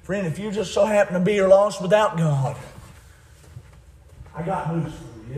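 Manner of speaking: normal talking voice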